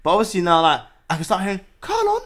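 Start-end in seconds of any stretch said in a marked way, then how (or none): 1.8-2.2 high sung-out Carlon; nurse calling name. Retold or imitated. Imitating the nurse calling the patient, high sing-song call. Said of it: "the nurse".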